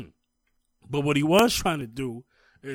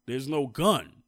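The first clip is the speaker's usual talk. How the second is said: usual speaking voice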